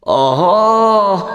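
loud, gleeful witch cackle; witch laugh. Acted. Witch laughs at the frightened girl, a loud cackle. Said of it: "zasmála se paní Trůda".

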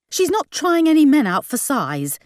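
high, indignant objection; hissed. A narrator's reading. Mum objecting to Gran's advice, high indignant hiss. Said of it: "hissed Mum".

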